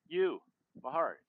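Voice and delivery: loud strained call. Acted. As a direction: call her name urgently. Voicing a character calling out.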